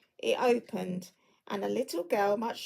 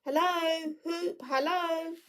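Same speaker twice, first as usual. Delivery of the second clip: higher, slow hallooing call; 8 semitones higher, slower than usual. Acted. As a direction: hail someone running far off. Scrooge calling out to Friday.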